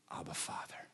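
breathy whisper of Abba, Father; whispered cry. Acted. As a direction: whisper it like a child's plea.

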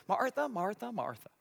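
a light hint of the whiny Brady Bunch call; Brady Bunch Marcia. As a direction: lightly mimic the famous Marcia, Marcia, Marcia. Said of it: "Brady Bunch fans enjoyed that one".

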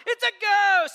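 high, loud hammed-up yelp of fright; cartoonish scared scream of a spooked sailor; mock panicked shout. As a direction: play up the terror for laughs. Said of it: "the first thing they say is kind of funny".

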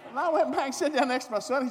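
high, loud and indignant; rising outrage; loud outrage returning to the bench. Real, not acted. Indignant retelling.

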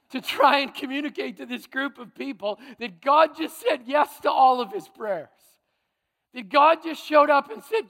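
rising to a fervent shout; preaching. Real, not acted. Preaching builds to full force.

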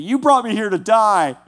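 hammed-up victim complaint; playing the victim. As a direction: whine like a self-pitying complainer. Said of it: "he's still the victim saying".

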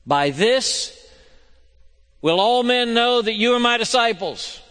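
rising, loud pulpit voice; fervent preaching. Acted. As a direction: proclaim it to the congregation. Preaching at full force.

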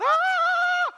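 high sharp cry of fright; sudden high yelp of fear; mock terrified scream. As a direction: shriek at the ghost on the water. Disciples cry out at ghost.

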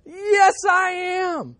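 drawn-out, very high defiant shout; slow, screeching madman's insistence. Acted. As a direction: wail it out stubbornly at the top of the voice. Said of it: "he cried all the louder".

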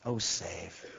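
soft, low, hushed plea; quiet plea. Shown as a demonstration. Preacher modelling a humble cry to God, soft and low. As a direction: murmur it like a humble prayer. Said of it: "And cry out to him".

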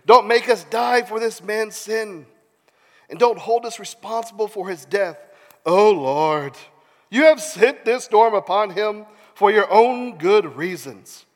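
mild theatrical pleading; earnest prayer read dramatically. A narrator's reading. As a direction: plead with emphatic key words. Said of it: "they pleaded".

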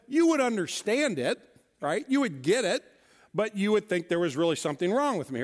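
ordinary talk

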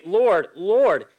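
"Lord" punched loud both times; two forceful cries of "Lord", each falling away; emphatic appeal. Acted. Many appealing to Jesus on that day, "Lord" emphatic twice. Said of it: "They're emphatically saying".

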